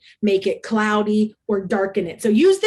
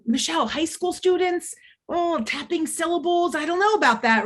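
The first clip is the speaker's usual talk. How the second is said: doubtful friend; 6 semitones higher than usual. Retold or imitated. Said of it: "my friend Roger ... he said".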